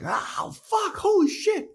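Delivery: high startled shout; shocked Xander. Retold or imitated. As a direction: yelp it like he just saw something awful. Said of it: "he's like".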